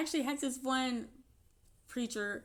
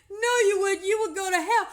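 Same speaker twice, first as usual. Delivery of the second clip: laughing preacher quote; 8 semitones higher, 9 dB louder than usual. Retold or imitated. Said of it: "He said".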